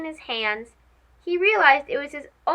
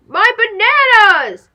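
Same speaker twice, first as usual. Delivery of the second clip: loud, slow wail over the bananas; anguished cry; 7 semitones higher, 10 dB louder than usual. Acted. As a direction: cry out in dismay, dragging it. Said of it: "cried Rico".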